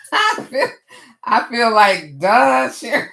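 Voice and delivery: high, loud self-mocking laugh; cracking up at her own mistake; laughing at herself. Real, not acted. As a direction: call herself silly through laughter. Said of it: "I'm laughing at myself".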